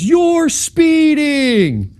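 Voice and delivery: high, drawn-out yell; mock accusing holler; shouting between cars. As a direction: call it across to the next car, stretching the words. Acting out shouting between moving cars.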